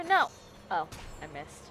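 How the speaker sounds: ordinary delivery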